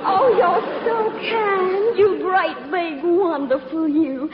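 flutter and gush with fake distress to win him over; a belle's breathless plea, climbing in pitch; gushing southern belle plea. Belle pleads for his drawing room.

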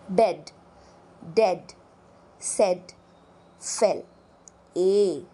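बेड, डेड, सेड, फेल said low and separate; teacher reads model words; e vowel demo. Shown as a demonstration. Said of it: "vowel e word list".

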